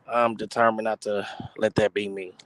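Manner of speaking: normal talking voice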